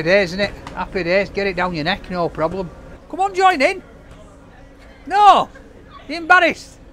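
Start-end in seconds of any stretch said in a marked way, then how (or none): 3.1-6.8 high, loud call across the room. Real, not acted. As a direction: call them over cheerfully. Calling out to people nearby.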